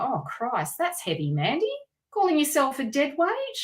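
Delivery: ordinary talk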